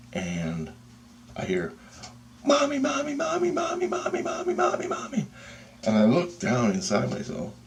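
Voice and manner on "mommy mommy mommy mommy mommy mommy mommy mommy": very high squeaky mock scream; tiny panicked cry, repeated without a break; screaming for mommy. Terrified thing calls for mommy, high mock scream. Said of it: "And it's screaming".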